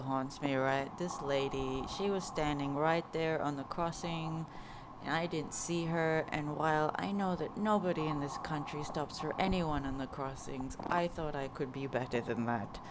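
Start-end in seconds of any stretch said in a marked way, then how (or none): none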